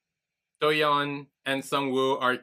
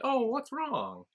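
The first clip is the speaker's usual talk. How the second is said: high, slow, sing-song tease; mock innocent taunt; 12 semitones higher, 5 dB quieter, slower than usual. Retelling the taunt with the figure, high sing-song tease. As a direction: sweetly taunt him.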